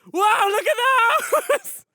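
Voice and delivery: high, loud mock-awed yell; amazed tourist. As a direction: shout in over-the-top wonder. Acted tourists spotting pirates.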